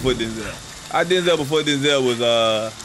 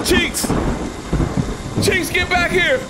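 calling out; slower than usual. Real, not acted. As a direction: holler for Cheeks to come back. Calling after someone running off.

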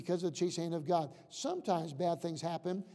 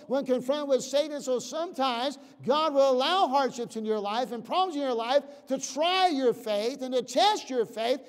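higher and louder, driving sermon cadence; preaching at full force; 6 semitones higher, 8 dB louder than usual. Acted. Preacher pressing the lesson of Job on the church, at full force. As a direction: preach it hard to the congregation.

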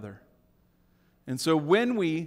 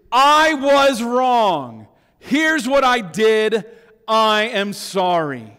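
slow, loud, every word hit; slow loud admission; emphatic confession; 6 dB louder, much slower than usual. Shown as a demonstration. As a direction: declare it plainly and hard. Preacher models real confession.

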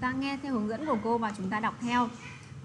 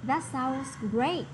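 slow, with great lifted; stress on great; much slower than usual. Shown as a demonstration. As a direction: lean on the word great and let it rise. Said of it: "Thì chúng ta nhấn vào great".

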